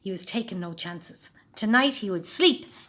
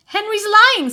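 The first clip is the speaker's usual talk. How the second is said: high, loud indignant accusation; accusing; 14 semitones higher, 13 dB louder, slower than usual. A narrator's reading. Peter accusing.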